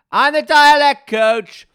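high, slow, full-force yell; indignant shout. Retold or imitated. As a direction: bellow it high, every word drawn out. Retold protest to the producers.

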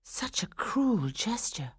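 soft, slow, high whispery thought; wounded private thought. A narrator's reading. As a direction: think it slowly, barely aloud. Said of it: "thought Swanhilda".

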